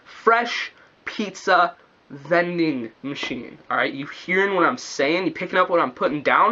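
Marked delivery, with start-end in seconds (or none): 0.0-3.6 drawn out, each word left to sink in; slow dramatic. Shown as a demonstration. Letting the viewers soak in the idea, slow and weighty. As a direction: spell it out slowly for effect. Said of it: "Let that soak in".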